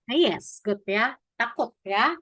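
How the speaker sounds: usual speaking voice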